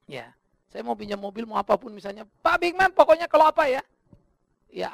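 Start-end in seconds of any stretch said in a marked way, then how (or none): 2.4-3.8 high, loud imitation of an eager host; eager offer. Grateful family offering the pastor anything, high eager call. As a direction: voice the family calling out their offer.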